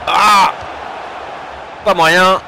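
loud, high groan at a miss; frustrated exclamation. Real, not acted. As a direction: cry out at the missed chance. Another missed chance.

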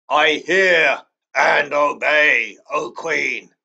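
slow, loud, low wolf growl. Acted. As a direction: growl it out like a beast. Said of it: "growled the wolf".

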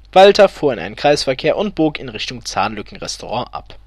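ordinary delivery

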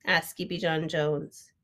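ordinary delivery, nothing marked

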